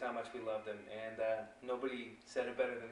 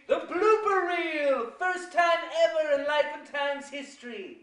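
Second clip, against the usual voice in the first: high, loud, stretched-out showman shout; announcer hype; 8 dB louder than usual. Real, not acted. Host hypes the blooper reel to viewers, big excited shout. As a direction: announce it like a game show host.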